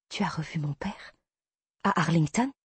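breathless, unvoiced disbelief; shocked whisper. Heroine stunned by revelation. (chuchotement stupéfait)

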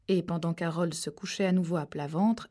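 usual speaking voice

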